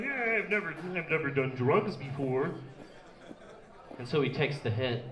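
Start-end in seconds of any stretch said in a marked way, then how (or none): 0.0-2.5 eager, innocent puppet voice, a bit softer; lightly voiced cheerful frog; naive Kermit voice. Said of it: "He's like".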